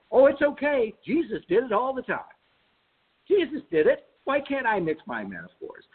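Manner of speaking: high, playful know-it-all comeback; triumphantly talking back to the teacher; cheeky retort. Retold or imitated. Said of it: "I could say".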